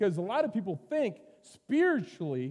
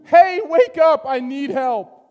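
shouted high and loud, waking him in panic; panicked cry for help; 10 semitones higher, 15 dB louder, slower than usual. Acted. Disciples shouting to wake the sleeping Jesus, panicked yell. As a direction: yell it like sailors in a sinking boat.

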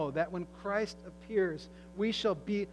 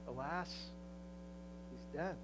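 softer, grave bishop breaking bad news; sorrowful hush; 5 semitones lower, 13 dB quieter than usual. Bishop telling John his young charge is lost, hushed and grave. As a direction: let the news fall softly, grieving.

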